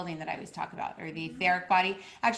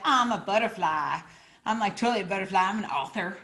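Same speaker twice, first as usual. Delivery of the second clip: mock ego bragging; 3 semitones higher, 6 dB louder than usual. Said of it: "that was, like, the, the ego".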